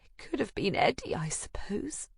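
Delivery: soft, breathy whisper; sighing whisper. A narrator's reading. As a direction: sigh the words out. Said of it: "I sigh".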